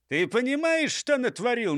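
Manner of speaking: high, mildly angry telling-off; scolding. A narrator's reading. Chief rounds on clumsy robber, moderately angry scolding. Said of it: "напустился на него шеф".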